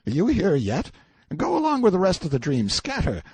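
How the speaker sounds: high, loud shooing; dismissive shout. A narrator's reading. Prisoner waves away a dream visitor, dismissive. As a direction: send the apparition packing, loud and high.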